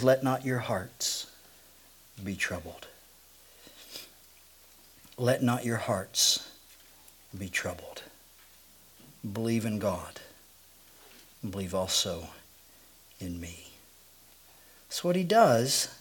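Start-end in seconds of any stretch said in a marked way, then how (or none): 0.0-2.9 tender, hushed. Acted. Pastor voicing Jesus comforting anxious disciples, tender hush. 5.2-13.7 tender, hushed. Acted. Pastor voicing Jesus comforting anxious disciples, tender hush. Repeated even softer.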